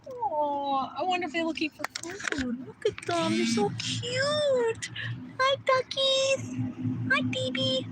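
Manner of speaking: high coo, stretched out on the cute words. Real, not acted. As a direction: melt over adorable little animals. Gushing over cute ducks.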